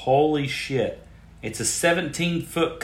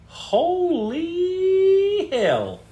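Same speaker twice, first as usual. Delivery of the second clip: high, drawn-out stunned exclamation; astonished; 4 dB louder, much slower than usual. Real, not acted. Host gaping at the giant's carving, astonished. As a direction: stretch it out in disbelief.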